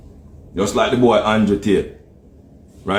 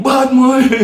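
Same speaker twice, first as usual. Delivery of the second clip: mock Jamaican catchphrase; 12 semitones higher, 5 dB louder than usual. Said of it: "Nobody else here like".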